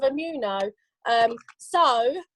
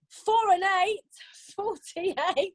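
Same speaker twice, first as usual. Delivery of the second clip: bingo caller; 4 semitones higher, slower than usual. Acted. Calls bingo numbers.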